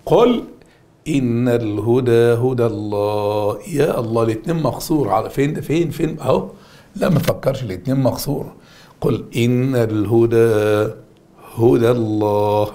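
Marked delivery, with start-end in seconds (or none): 0.1-3.6 Quran recitation. Shown as a demonstration. Teacher quotes a Quran verse. 9.0-12.7 slow Quran recitation. Shown as a demonstration. Teacher reciting a verse to the class, slowly.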